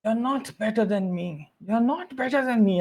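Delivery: insistent villain rant, voice lifting on the repeat; mock ranting. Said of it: "he just, like, keeps ranting".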